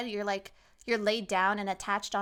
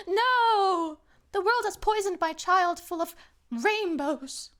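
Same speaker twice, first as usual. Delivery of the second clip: loud, high, drawn-out mock horror; melodramatic mother; 9 semitones higher, 6 dB louder, slower than usual. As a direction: wail as a hammed-up horrified mother. Acted mother horrified by colors.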